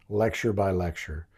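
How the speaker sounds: normal talking voice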